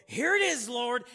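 loud, high mock Cain calling up to God; acted loud, high announcement; proud presenting. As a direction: belt it out as a showy offering. Acting Cain proudly presenting his offering.

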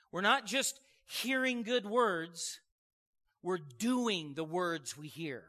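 slow, deliberate sermon stress; slow preaching emphasis. Real, not acted. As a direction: lay each word down slowly for the congregation.

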